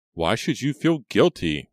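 high, loud impatient demand; irritated. A narrator's reading. Reva demands why he feels guilty, irritated. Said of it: "sounding more irritated than sympathetic".

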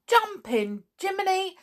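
Nobby can't believe his ears; amazed demand. A narrator's reading. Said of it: "he demanded".

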